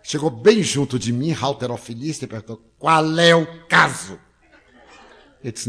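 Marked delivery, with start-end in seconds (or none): 2.8-4.2 slow, louder, menacing question; tough-guy challenge. Retold or imitated. Bodybuilder confronting the grinning man, slow tough challenge. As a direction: square up to him word by word.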